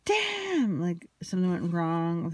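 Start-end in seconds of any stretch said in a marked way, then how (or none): 0.0-0.8 high-pitched mock outburst; imitated shout. She curses over her painting, imitated high shout. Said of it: "she starts kinda shouting".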